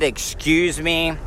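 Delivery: sarcastic excuse me. Retold or imitated. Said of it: "intent has a lot to do".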